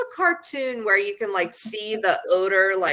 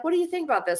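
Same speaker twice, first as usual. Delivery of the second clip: asking friends; 9 semitones higher, much faster than usual. Retold or imitated. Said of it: "I'm like".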